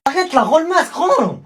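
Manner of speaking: high angry yell to get the mask back; snatch it back, yelling; furious kid demanding the mask back; angry shout. Acted. Fight over the mask.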